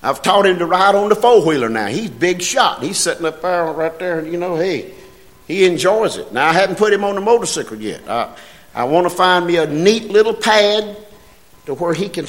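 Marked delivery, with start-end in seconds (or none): none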